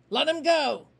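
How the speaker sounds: loud commanding growl. A narrator's reading. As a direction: order the gang like a threat. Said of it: "Finch growled".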